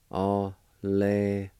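Alehuistote drawn out slowly, each syllable apart. Shown as a demonstration. Teacher to students learning Cherokee: slow, careful sounding out. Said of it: "is pronounced like this".

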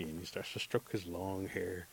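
ordinary delivery, nothing marked

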